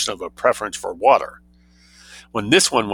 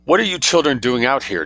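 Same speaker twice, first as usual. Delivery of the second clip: demanding; 5 semitones higher than usual. A narrator's reading. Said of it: "demanded Missus Quabarl".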